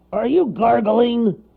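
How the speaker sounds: loud, slow, high incredulity; flabbergasted bear voice; amazed bear. A narrator's reading. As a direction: ask it in loud disbelief. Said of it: "he asked in amazement".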